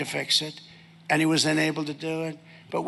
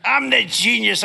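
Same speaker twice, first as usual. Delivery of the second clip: higher and louder brag; boastful shout; 6 semitones higher, 7 dB louder than usual. Real, not acted. Trump bragging to rally crowd, loud boast. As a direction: crow it out.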